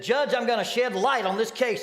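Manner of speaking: a showy young lawyer playing to the room; cocky lawyer booming to the judge. Hotshot lawyer's bold line.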